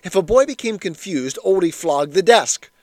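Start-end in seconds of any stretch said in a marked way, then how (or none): none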